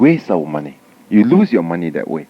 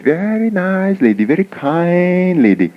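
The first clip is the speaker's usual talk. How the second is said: high, slow, drawn-out admiring gush; gushing praise; 8 semitones higher, slower than usual. Acted. Villagers praising the rich lady, gushing. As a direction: draw out the praise, high and sing-song.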